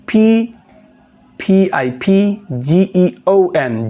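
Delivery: spelling a word aloud for students; spelling letter by letter. Shown as a demonstration. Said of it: "spelling out".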